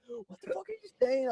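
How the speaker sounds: reacting to the canvasser, mock shocked and high; mock outrage. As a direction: act shocked at what you just heard. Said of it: "So instantly I'm like".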